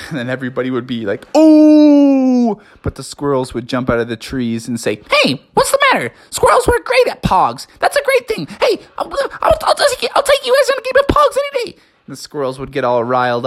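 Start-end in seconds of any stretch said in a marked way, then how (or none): 1.3-2.6 high, loud, long ooh; crowd's taunting ooh. A narrator's reading. Everybody reacts to the squirrel insult, loud ooh. 5.1-11.8 very high, squeaky chatter; indignant squirrels. A narrator's reading. Squirrels defend their pog skills, high indignant squeaking.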